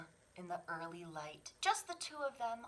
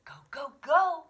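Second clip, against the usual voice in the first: urgent cheer; 9 semitones higher, 14 dB louder than usual. Acted. Mother urging cub.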